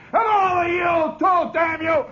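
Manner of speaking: high, loud imitated schoolboy yell; retold yell. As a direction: yell furiously at the whole class. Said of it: "Farkas yells down at all the kids".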